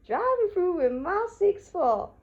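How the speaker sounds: high, loud rapper impression. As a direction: playfully belt out a high-pitched rap line. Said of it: "[name], say it like you mean it".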